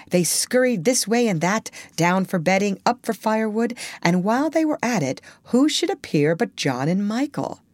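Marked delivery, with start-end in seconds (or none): none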